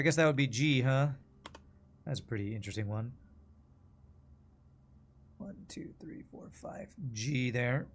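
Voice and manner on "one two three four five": very soft, voiceless count; whispered counting. Real, not acted. Player working out which letter it is, whispered. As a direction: count under your breath.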